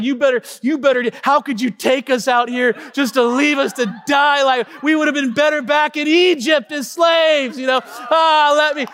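high, loud mock rant; high-pitched mock scolding from the back seat; mock furious wife. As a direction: ham up the wife's outrage. Wife shouting at him in car.

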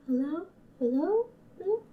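hello fading into distance; echo. Acted. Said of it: "Echoes through".

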